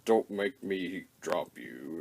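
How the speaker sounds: a huge robot calmly warning the little one; giant robot warning. Retold or imitated. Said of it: "Metroplex is sitting there going".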